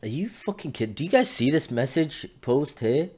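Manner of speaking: ordinary talk, nothing marked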